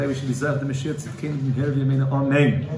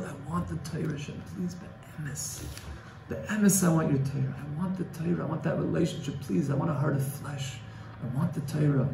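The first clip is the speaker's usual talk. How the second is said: much softer, breathy whispered plea, nearly silent at Be'emes; hushed pleading prayer; 8 dB quieter than usual. Acted. Teacher voicing a student's private prayer, hushed and pleading. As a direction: beg Hashem under your breath for the Torah.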